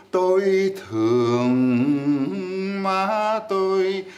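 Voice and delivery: very slow Vietnamese with altered tones; thương ma tôi very slow, tones shifted; changed tones demo. Shown as a demonstration. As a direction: stretch the phrase out on the wrong tones. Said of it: "Et si vous dites".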